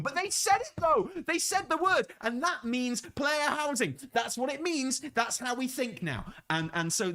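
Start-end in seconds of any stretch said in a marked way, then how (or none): 0.0-2.1 gushing like a fan who caught a hint; excited squeal. Retold or imitated. Fan shrieking at a quest line. 2.2-6.3 mock fan certainty.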